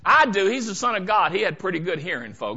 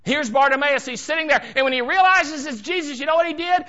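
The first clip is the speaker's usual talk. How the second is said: ordinary talk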